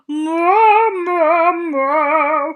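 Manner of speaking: loud, high booming moo; mooing moose. Acted. Lonely moose moos loudly across the ice.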